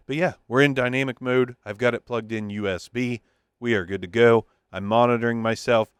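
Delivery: normal talking voice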